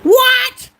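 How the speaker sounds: high, loud, stunned cry of disbelief; shocked shout. Real, not acted. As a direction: blurt it out in total shock. Shocked at sandwich news.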